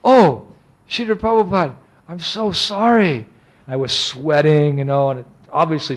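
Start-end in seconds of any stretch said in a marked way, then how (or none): 0.1-3.3 high-pitched, flustered apologizing; mortified apology. Retold or imitated. Disciple to his guru after nearly bumping him, mortified. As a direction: apologize in alarm, drawing out the sorry.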